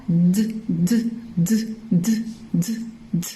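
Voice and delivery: D as a short, soft /d/ sound, repeated with gaps; isolated letter sound. Shown as a demonstration. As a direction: tap out "D" as a bare consonant, no vowel name. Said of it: "couper la syllabe. On va faire".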